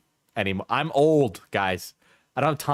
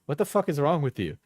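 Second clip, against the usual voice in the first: normal talking voice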